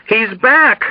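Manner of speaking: high, panicked cry; delirious patient's high-pitched cry of fear; terrified echo. A narrator's reading. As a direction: echo the alarm in terror. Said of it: "echoed in automatic terror".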